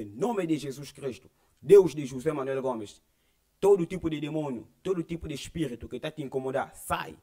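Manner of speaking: loud on the opening names of God, then sinking to a firm command; a retold deliverance prayer, declaimed; commanding exorcism prayer. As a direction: drive the spirits out with authority. Retold casting out of demons.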